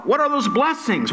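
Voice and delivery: usual speaking voice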